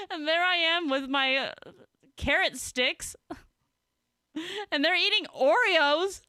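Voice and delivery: high-pitched, cracking up while talking; real giggly disbelief, pitched high; laughing. Real, not acted. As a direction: squeeze the words out between laughs. Cracking up while telling the story.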